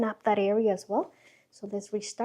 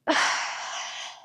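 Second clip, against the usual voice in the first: breathy release sigh; long sigh; 4 dB quieter than usual. Shown as a demonstration. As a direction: let it all out in one long sigh. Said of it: "the sighing sound".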